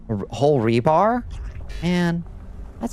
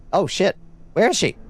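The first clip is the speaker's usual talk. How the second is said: startled alarm; 8 semitones higher than usual. Real, not acted. Alarmed, hearing enemy in game.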